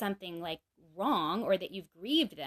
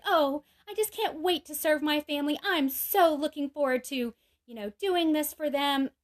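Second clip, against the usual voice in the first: mock eagerness; 6 semitones higher, 3 dB louder than usual. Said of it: "I may not be like".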